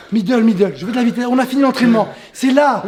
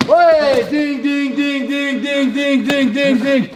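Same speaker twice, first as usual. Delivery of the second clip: imitated round bell; much slower than usual. Coach calling the end of the round, mimicking a bell. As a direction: ring out like a bell in an even run.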